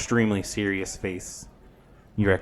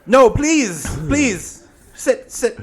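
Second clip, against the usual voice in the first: campy drag queen; 9 dB louder, slower than usual. Acted. GM voices Granny Who.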